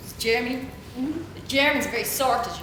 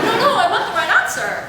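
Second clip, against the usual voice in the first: insistent student; 10 semitones higher, 7 dB louder than usual. Retold or imitated.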